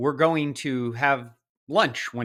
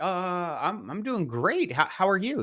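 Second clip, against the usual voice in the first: taken aback, much higher voice; shocked; 7 semitones higher than usual. Retold or imitated. Passer-by caught off guard by a stranger's greeting, shocked. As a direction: stall on a drawn-out "uh", then answer high and thrown. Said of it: "I was kinda shocked".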